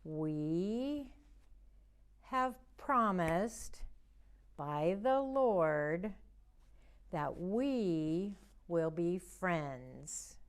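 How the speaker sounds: slow, word by word, for signing along; slow signing recital. Shown as a demonstration. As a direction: stretch each word for the signs. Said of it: "Let's do that one more time".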